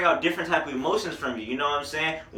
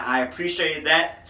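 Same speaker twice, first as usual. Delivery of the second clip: ordinary talk, nothing marked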